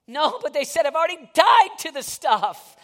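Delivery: loud, high, driving sermon voice; full-force preaching. Acted. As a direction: preach it out with fire.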